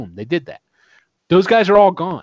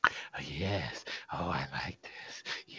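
very soft, low, whispered approval; hushed in-character savoring of a plan; delighted whisper; 10 semitones lower, 18 dB quieter than usual. Acted. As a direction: whisper it with relish, barely audible.

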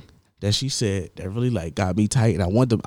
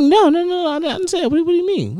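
high, flustered protesting, a lot higher; flustered manager denial. Retold or imitated. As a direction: act her panicky backpedaling.

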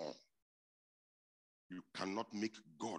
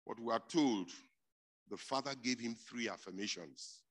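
ordinary talk

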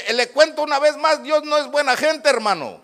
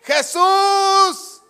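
high, loud drawn-out shout; long, desperate yell; crying out; 9 semitones higher, 7 dB louder, much slower than usual. Acted. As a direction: cry out the name like a blind man pleading. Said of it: "Más gritó".

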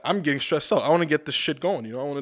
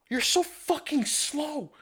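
much higher, slow, drawn-out sneer; imitating condescending waitress; 13 semitones higher, much slower than usual. Waitress slamming the counter at the bartender, high slow sneer. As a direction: stretch every word like she's talking down to you. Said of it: "didn't copy her voice properly".

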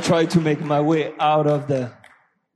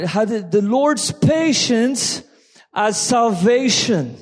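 raised, full-voiced sermon delivery; preaching; 7 semitones higher, 6 dB louder than usual. Real, not acted. Pastor exhorts congregation about waiting, preaching loudly. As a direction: preach it, pressing each phrase. Preaching Second Peter passage.

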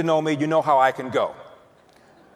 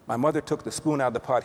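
ordinary delivery

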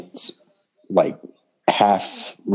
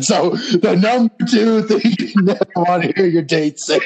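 much higher, put-on announcing voice; high-pitched mock announcement; 10 semitones higher than usual. Cohost redoes the setup as number two, high mock announcement. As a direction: pitch the whole title way up.

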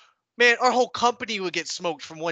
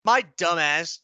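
ordinary talk, nothing marked

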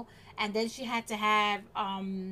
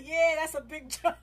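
laughing quote; 6 semitones higher than usual. Real, not acted. Retelling the plumber's reply, laughing through it. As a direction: quote the plumber while cracking up.

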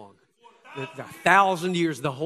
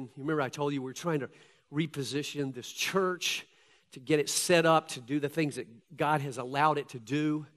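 ordinary talk

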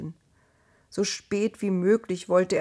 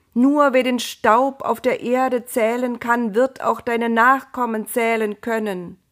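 raised, booming voice of God making a promise; divine voice; 5 semitones higher, 5 dB louder than usual. Acted. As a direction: speak as God from above, grand and certain. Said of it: "hörte er zum zweiten Mal die Stimme".

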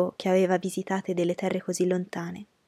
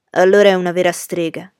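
loud, emphatic exclamation; lightly voiced young man's outburst; exclaiming; 9 dB louder than usual. A narrator's reading. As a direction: exclaim it with a raised voice. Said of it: "esclamò Frederick Lynn".

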